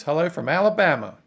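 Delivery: put-on reading a chat greeting in a light Southern Alabama accent. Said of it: "That was my Alabama accent".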